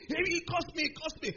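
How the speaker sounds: high, loud repeated complaint; very angry. Retold or imitated. Big student appealing to the onlookers, high mock outrage. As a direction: mimic the bully crying foul. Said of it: "the guy got very angry".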